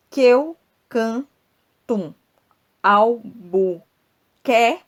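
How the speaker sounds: very slow scansion with a loud rising quer; scanning stresses. Shown as a demonstration. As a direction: pause between chunks and hit quer hardest. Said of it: "uma pausa pra mostrar onde é que fica essas tonicidades".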